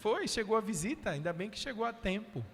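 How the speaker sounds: gleeful demon. Retold or imitated. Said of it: "Aí o demônio".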